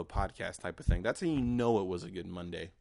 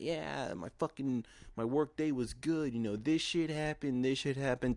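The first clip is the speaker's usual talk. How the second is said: fake cheerful; 6 semitones higher than usual. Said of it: "totally pretending like I love my job".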